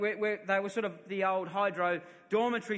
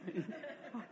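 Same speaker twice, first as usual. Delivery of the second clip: soft breathy laugh; 12 dB quieter than usual. Real, not acted. Laughs at own danger.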